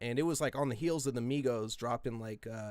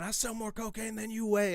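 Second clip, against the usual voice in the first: retold scream; 9 semitones higher than usual. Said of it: "And I'm screaming".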